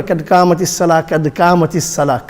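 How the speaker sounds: thin front k in قَدْ and قَامَتِ, quickly; small qaf. Shown as a demonstration. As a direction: say قَدْ قَامَتِ as light "kad kaamat". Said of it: "They're not a small qaf".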